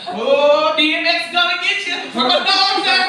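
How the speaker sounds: loud shouted rap hype; loud shout, laughing in hype; hyped shout. Retold or imitated. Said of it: "now I'm really hyped up".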